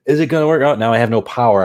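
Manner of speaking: high, loud mock fretting; mock alarm. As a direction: act out a sudden little tech panic. Replays testing mishap with mock alarm.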